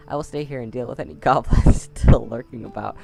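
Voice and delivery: reading the line while bursting into loud laughter; cracking up. Real, not acted. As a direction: laugh through the words, real giggles. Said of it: "I don't know why that tickled me so much".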